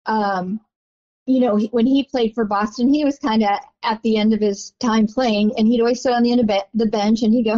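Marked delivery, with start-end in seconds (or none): none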